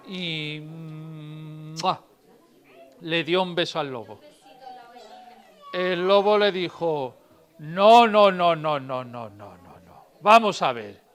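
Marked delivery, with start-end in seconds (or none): 0.6-2.0 a smacking kiss noise; quick mua of a kiss; kiss sound. Acted. Sheep kisses the wolf. 7.6-10.1 starts with a loud cry then fades to nothing; wolf's panicked refusal dying away; fading no no. Acted. Wolf protests the kiss.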